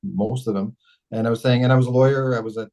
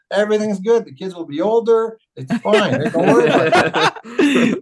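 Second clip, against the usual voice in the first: retold breezy reassurance, pitched high; high, loud mock reassurance breaking into laughter; over-eager reassurance; 8 semitones higher, 5 dB louder than usual. Husband soothing his wife about running, over-eager reassurance.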